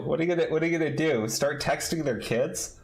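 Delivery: normal talking voice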